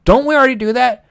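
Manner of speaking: high, loud baffled reply; mock Bill Gates. Bill Gates, asked about buying chat software, answers in high mock bafflement. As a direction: pitch it up like a puzzled billionaire. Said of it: "He's like".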